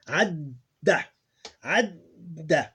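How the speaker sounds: "adda" with the middle d held, short final a; slow, emphasized double d in "adda"; stressed doubled consonant. Shown as a demonstration. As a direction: linger on the d in "adda", then release it short. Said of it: "like you're stressing, emphasizing the short sound".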